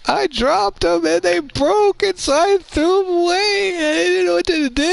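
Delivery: very high loud mock wail, slowed; whiny kid confession. Speaker mocks the boy confessing in a crying high wail. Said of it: "sure enough".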